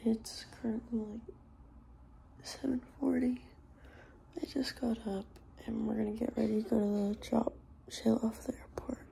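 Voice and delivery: hushed morning whisper. Real, not acted. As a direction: whisper so nobody wakes. Early morning, roommates asleep.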